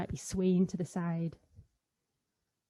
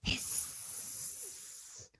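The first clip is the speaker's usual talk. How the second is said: a long breathy cat hiss, much softer; angry cat hiss; 16 dB quieter than usual. Pretend. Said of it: "angry cat".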